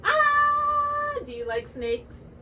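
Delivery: put-on slow, sing-song voice for little kids; cheerful preschool teacher, drawn out; kids' teacher voice. Leads a young kids' class.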